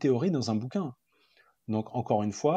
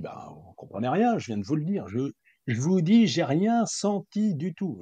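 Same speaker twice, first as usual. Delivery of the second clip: higher and slowing, hammered out in annoyance; retold exasperation; 6 semitones higher, 4 dB louder than usual. Patient scolding her sophrologist for not listening, exasperated. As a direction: insist like an irritated patient who feels unheard. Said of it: "un peu presque en pétard".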